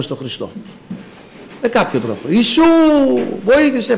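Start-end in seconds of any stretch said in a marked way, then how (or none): 2.3-4.0 mock cry for help. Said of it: "φώναζε ο άλλος".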